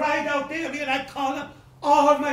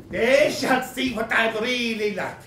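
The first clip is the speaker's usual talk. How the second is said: ordinary delivery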